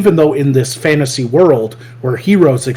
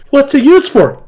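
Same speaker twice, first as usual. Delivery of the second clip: very high, louder child imitation; little girl; 12 semitones higher, 5 dB louder than usual. Little girl asking Goblin Slayer about his sword, high-pitched imitation. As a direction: pipe up as an eager kid. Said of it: "the Goblin Slayer little girl".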